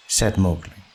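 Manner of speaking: ordinary delivery, nothing marked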